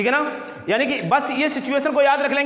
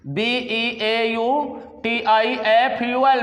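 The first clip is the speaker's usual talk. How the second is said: slow spelling; much slower than usual. Shown as a demonstration. Teacher spelling beautiful slowly on the board. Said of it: "यहाँ पर यही लिख दूं मैं beautiful".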